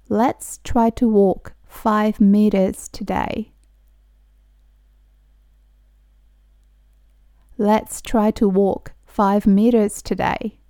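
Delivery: slow, careful teacher pronunciation; slow model reading. Shown as a demonstration. Teacher reading slowly for students to repeat. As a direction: spell each word out slowly for learners. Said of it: "私と一緒に発音してもらったら".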